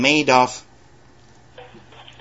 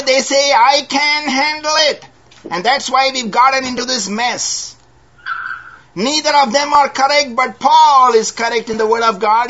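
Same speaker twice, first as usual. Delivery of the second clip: high, ringing preacher's voice; fervent pulpit delivery; preaching; 11 semitones higher, 5 dB louder than usual. Acted. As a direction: lift it up to the congregation. Preaching against "I can't.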